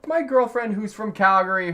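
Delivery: ordinary delivery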